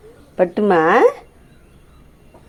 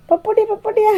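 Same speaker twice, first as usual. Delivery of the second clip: much higher, sing-song pet talk; 10 semitones higher than usual. Real, not acted. Owner cooing up high to the puppy, high baby talk.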